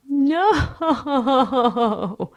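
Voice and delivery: loud, high wail breaking into sobs; sobbing wail. A narrator's reading. Lewis refuses before the guard, wailing. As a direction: draw it out in high, racked sobs. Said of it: "Lewis wails, racked with sobs".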